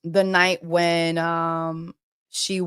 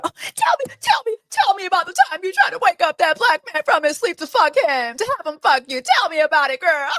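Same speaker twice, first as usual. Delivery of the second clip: much higher, fast, gushing mock interviewer; mock a nosy host pushing for gossip; Alex Cooper impression; 9 semitones higher, 4 dB louder, faster than usual. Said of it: "You got Alex Cooper".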